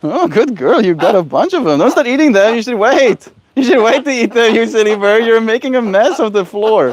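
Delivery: high, singsong voice for a pet bird; pet talk. Acted. Owner gently scolding her bird, high singsong pet talk. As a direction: coo and scold the bird like a baby.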